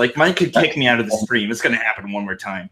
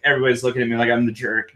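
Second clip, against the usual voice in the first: usual speaking voice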